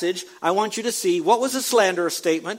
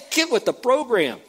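loud, higher scolding jab; mock exasperation; 7 semitones higher, 5 dB louder than usual. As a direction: tell them off, half joking.

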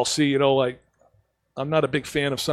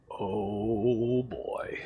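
lower, hushed under the breath; soft lower mutter, half voiceless; muttered oh boy; 5 semitones lower, 11 dB quieter than usual. Retold or imitated. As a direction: say it mostly to yourself, dismayed. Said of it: "I'm like".

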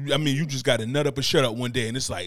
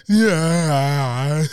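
imitating a sitcom kid's bawl; drawn-out whiny mock crying; snotty kid cry; 2 semitones lower than usual. Comic imitating a TV snotty kid, mock cry. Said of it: "the little snotty kid from Martin".

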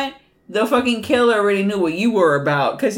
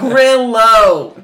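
Grillo punched out loud; emphatic name repeat; 7 dB louder than usual. Acted. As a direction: boom Grillo as two drawn-out beats.

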